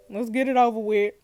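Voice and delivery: usual speaking voice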